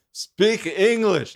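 high, drawn-out mock heckle; heckler's call. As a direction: imitate a heckler calling out, slowly. Acting out the heckler.

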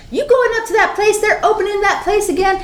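high, fast busybody voice; high-pitched gossipy disbelief; alarmed townsfolk. Acted. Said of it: "they're like".

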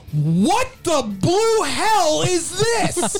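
high, slow then shouting disbelief; exasperated rant. Retold or imitated. Fan texting his friend mid-game, a hammed-up furious shout. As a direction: yell at the TV in disgust. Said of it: "I texted Andrew ... and going".

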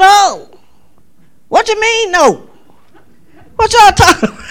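high, loud incredulous question with a laugh; shocked laughing disbelief. Retold or imitated. Leader reacting to kids saying no, shocked and laughing.

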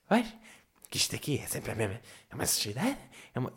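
low, soft grumble under the breath; muttering to herself. Retold or imitated. Neighbour sweeping and talking to herself, muttering. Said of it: "Está a falar sozinha".

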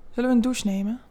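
plain reading voice

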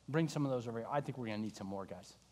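Jesus calling low and easy, fading away; soft easy call. Acted. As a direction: toss it off gently.

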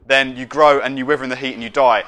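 ordinary talk

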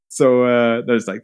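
ordinary talk, nothing marked

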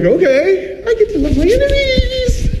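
very high, gushing fake glee; hammed-up chipper delight; mock cheerful eagerness. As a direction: chirp it like it's a treat. Mock eager reply to Hitler.

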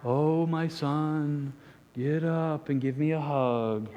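slow, sugary mock-tender Jesus; soft gentle Jesus. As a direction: croon it like a dreamy movie Jesus. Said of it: "Brad Pitt Jesus in their mind".

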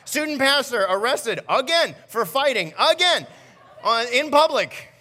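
high, loud voice of the wife scolding; wife lecturing her husband in the car, high and loud; mock-scolding wife. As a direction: play the exasperated wife, hammed up. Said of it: "She's like".

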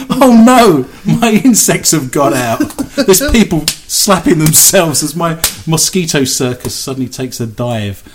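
loud, panicked ringmaster cry; a showman whose act escapes; grandiose flea circus showman. Pretend.

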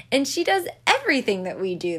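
usual speaking voice

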